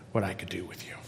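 whispered. Acted. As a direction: breathe the promise out softly. God's words, ending in whisper.

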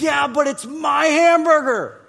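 put-on high, loud kid's protest with a laugh; son protesting. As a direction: whine it like a possessive child. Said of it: "He says".

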